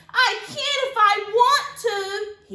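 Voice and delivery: put-on high, loud childish protest; little brother fighting to come along; whiny little brother. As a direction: argue like a stubborn small boy. Sibling quarrel.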